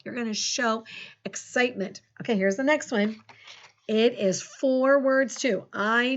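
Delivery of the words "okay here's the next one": ordinary talk, nothing marked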